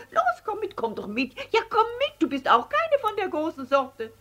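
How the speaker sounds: very high squeaky mouse chorus; high, squeaky protest chant; squeaky mice. Acted. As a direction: squeak it out like a crowd of marching mice. Marching mice invite the little one.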